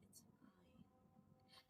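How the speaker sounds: barely audible breathy brush-off; whispered. Retold or imitated. Wife hiding her bruises from her lover, whispered. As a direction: whisper it so no one hears.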